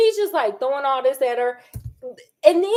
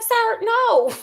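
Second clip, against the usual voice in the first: high sharp no; 11 semitones higher than usual. Retold or imitated. Voicing Ingrid's refusal.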